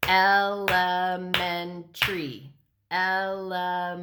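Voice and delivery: "Elementary" in four level, separated beats, el-e-men-tary; clapped-out syllables. Shown as a demonstration. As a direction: chant "Elementary" syllable by syllable on one note. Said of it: "when you can clap out the word".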